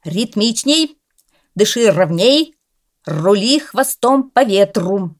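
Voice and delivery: the coach cat riding the dog, calling commands; commanding. A narrator's reading. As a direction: call out the drill like a coach. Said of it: "командовал".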